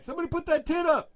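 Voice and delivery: high, fast crowd shout; mock heckle. Imitates onlookers calling out.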